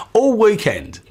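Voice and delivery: high, bright-toned mock Brit; chirpy faux Cockney; mock British accent. Actor echoing Vin's British phrase, pitched high and loud. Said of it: "his British accent".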